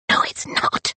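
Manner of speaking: whispered under her breath; whispered retort. Acted. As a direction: Rosalind whispering back at the watchman. Rosalind mutters in reply.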